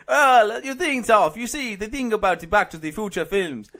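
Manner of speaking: mock-Italian opening, a laugh in it; badly faked Italian; Italian accent. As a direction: launch into a comic Italian accent. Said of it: "Thirty seconds in Italian".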